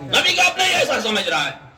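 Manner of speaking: high, quick, indignant-style repeat; high-pitched rapid preacher's exclamation; emphatic repetition. Real, not acted. Preacher repeats point for the crowd.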